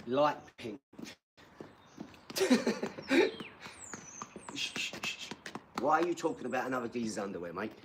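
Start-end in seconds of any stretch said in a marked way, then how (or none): 2.3-5.7 high breathy laugh. Real, not acted. Laughing at troll.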